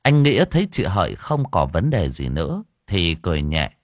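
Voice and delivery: ordinary delivery, nothing marked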